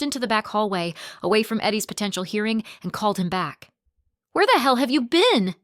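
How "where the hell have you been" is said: slurred angry shout; angry drunk. Acted. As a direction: bark it like a drunk. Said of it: "His voice was slurred, angry".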